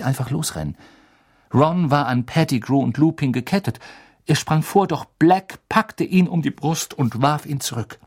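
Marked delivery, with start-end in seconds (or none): none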